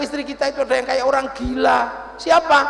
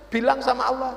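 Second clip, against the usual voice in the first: ordinary talk, nothing marked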